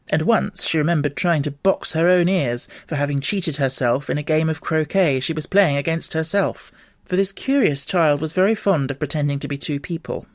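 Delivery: ordinary delivery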